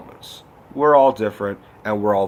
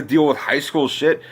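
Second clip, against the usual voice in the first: usual speaking voice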